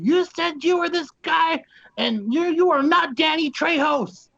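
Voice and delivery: high, slow mock-outraged yell; irate accusation. Assistant director exposing the wrong actor, high irate accusation. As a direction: draw out each accusing word up high.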